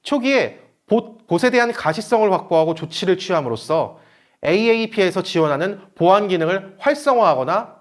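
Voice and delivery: ordinary talk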